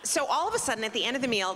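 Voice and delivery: ordinary talk, nothing marked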